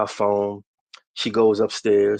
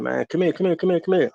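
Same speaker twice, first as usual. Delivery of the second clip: imitating his lady calling out, rising much higher; retold woman in the hallway calling, high and insistent; 7 semitones higher, faster than usual. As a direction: keep calling him over, more pressing each time. Lady calling him again, insistent.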